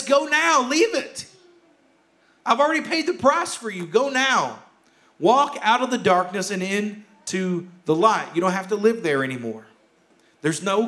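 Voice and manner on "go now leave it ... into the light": much higher, impassioned urging; soaring urgent plea. Retold or imitated. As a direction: cry out to her to leave the dark now. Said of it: "There's a sense of urgency to this".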